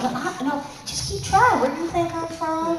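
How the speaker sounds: high teasing voice daring him to guess. Retold or imitated. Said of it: "I'm like".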